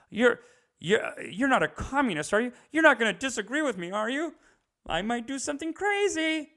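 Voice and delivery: high mock-tearful voice, climbing; pleading, hammed-up verge of tears; quivering, near tears. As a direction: imitate a man about to cry mid-argument. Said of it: "his fucking voice is quivering".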